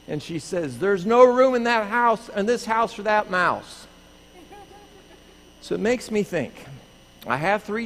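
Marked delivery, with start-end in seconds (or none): 0.8-3.9 higher-pitched, emphatic imitated refusal; insistent retort. Wife refuses husband's mouse, insistent retort. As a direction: put your foot down, lightly mimicked. Acted wife's firm refusal.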